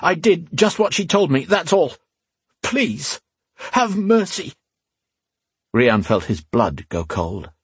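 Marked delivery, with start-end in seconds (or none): 0.0-4.5 high, panicked confession; frightened begging; desperate pleading. A narrator's reading. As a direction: beg for your life, voice pitched up. Frightened man confesses and begs mercy.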